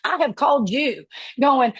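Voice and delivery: usual speaking voice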